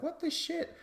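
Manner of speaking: very high, squeaky mock indignation; pissed-off disbelief. Podcaster voicing the annoyed contestant, high mock indignation. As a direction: imitate him squeaking in disbelief. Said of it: "[name] truly is getting pissed".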